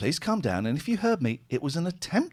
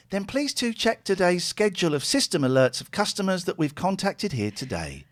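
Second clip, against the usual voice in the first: high call-centre woman; high lady voice; 6 semitones higher than usual. Acted. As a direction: keep the voice high and polite. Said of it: "I'm a lady".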